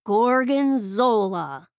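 "Gorgonzola" drawn out slow and loud after a pause; dramatic reveal. A narrator's reading. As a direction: unveil the name "Gorgonzola" slowly, like a big reveal.

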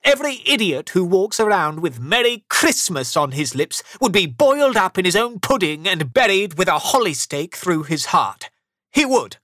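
high, loud indignant threat; angry rant. A narrator's reading. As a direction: rail like an outraged miser. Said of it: "Scrooge said angrily".

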